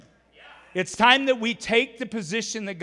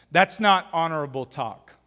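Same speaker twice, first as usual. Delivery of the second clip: ordinary delivery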